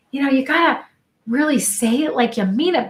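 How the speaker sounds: ordinary talk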